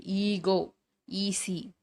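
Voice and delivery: "ஈகோ", "ஈசி" with a long "ee" start; long ஈ demo. Shown as a demonstration. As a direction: sound out "ஈகோ" and "ஈசி" singly, holding the "ee". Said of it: "இது எல்லாமே ஈ". ("ஈகோ", "ஈசி" நீண்ட ஈ ஒலியுடன்)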